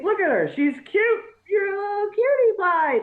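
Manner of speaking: high-pitched, doting coo; cooing baby talk. Pretend. Dad shows off his little daughter, cooing baby talk. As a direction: coo at her like a tiny baby.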